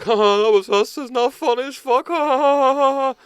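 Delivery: high, loud hammed-up cackling taunt; gleeful mocking shout ending in long ha-ha-ha; mock gloating laugh. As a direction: imitate pranksters crowing with forced laughter. Retold pranksters gloating with mock laughter.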